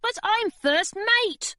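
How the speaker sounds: Charlie protesting. A narrator's reading. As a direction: plead rank. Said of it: "said Charlie".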